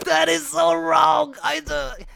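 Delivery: high, loud drawn-out protest; laughing outcry. Real, not acted. Reacting to their own accent take, loud high outcry. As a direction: squeal it out, scandalized.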